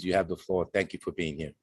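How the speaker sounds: usual speaking voice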